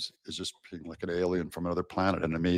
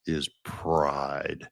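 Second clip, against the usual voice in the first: lower, imitating Galadriel's disdain; disdainful; 4 semitones lower than usual. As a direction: let a little scorn fall on pride. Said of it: "she has this real disdain in her voice".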